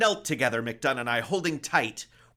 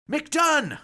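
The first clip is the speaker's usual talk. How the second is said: high, loud desperate yell of a name; cry out; 11 dB louder than usual. Acted. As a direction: scream his name over the roaring sea. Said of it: "I cried".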